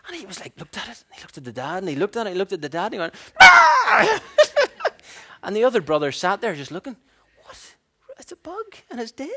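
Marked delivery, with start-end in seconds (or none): 3.4-4.2 put-on very high, loud shriek of a small child; child's shriek. Boy scared by a dead ant, shrieking. 4.3-5.4 a very high real burst of laughter. Real, not acted. Preacher laughing at the shrieking child, high laugh. 7.4-9.3 put-on high, soft deadpan child voice. Unbothered twin looking at a dead bug, deadpan child.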